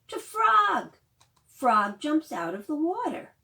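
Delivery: loud, lively storytime reading; reading to children. Acted. Librarian reading The Rainbow Hat aloud to children, lively. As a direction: bring the picture book alive for little ones. Reads picture book to children.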